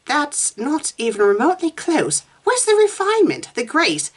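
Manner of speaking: high, appalled correction; pained disapproval. A narrator's reading. Susan wincing at Meg's imitation, appalled. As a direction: pitch it up in fussy dismay. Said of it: "Susan winced".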